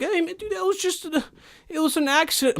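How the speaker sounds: high, soft, nervous excuse; pleading driver voice. Pretend. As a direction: beg your way out like a scared guy.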